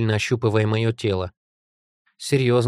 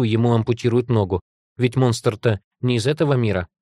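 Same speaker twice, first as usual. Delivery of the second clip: plain reading voice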